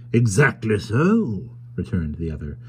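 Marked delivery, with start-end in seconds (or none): 0.1-1.5 drawn-out, swooping agreement; eager assent. A narrator's reading. Tackleton hastily agreeing with the carrier, over-eager assent. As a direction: agree with exaggerated readiness. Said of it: "with an unusual alacrity of assent".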